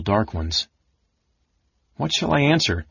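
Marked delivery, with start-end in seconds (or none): 1.9-2.8 high, mildly frantic wondering. A narrator's reading. Tas at a loss how to answer the mage, frantic. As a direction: think it in a small panic. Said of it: "Tas wondered frantically".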